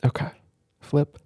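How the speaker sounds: ordinary delivery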